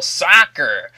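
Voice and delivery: put-on American accent. Player renaming football for viewers, exaggerated American accent. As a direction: say it in a loud mock American accent. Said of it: "That was my American accent".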